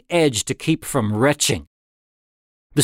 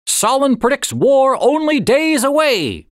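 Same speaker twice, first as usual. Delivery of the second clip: much higher, louder newsreel announcer; headline announcer; 12 semitones higher, 4 dB louder than usual. A narrator's reading. Narrator reading the war headline as a loud announcer. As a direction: bark the headline like a newsboy.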